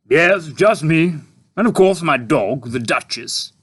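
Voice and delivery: a hungry tramp asking; puzzled tramp. A narrator's reading. Tramp character.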